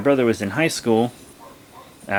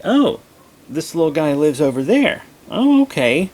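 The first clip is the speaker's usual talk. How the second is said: high, louder surprised realization; 6 semitones higher, 5 dB louder than usual. Retold or imitated. She learns from her dad where the dog lives, surprised. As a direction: caught off guard, voice jumping up.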